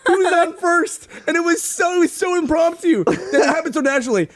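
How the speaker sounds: high voice still shaking with laughter; laughing disbelief. Real, not acted. Laughing to cohosts about the absurd mix-up, high and giddy. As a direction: squeak it out between laughs. Laughing after the funny story.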